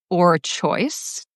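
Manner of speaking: usual speaking voice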